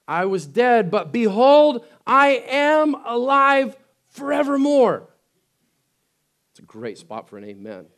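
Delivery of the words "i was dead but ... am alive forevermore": much louder and higher, slow ringing finish; triumphant shout. Acted. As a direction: shout the victory. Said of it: "Didn't stay like that".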